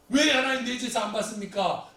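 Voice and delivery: loud, high accusing question; angry protest. Acted. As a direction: burst out at God in anger. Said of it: "하나님께 막 화내고 분하여 나가요".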